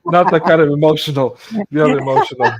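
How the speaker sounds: laughing loudly through the correction. Real, not acted. Host laughs off cohost's teasing, laughing loudly.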